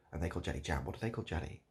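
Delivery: ordinary talk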